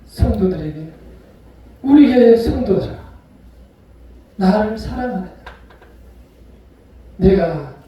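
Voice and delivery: normal talking voice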